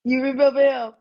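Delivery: a sudden jump of surprise; surprised. Real, not acted. Host remembers Big Mike, and she asks back surprised.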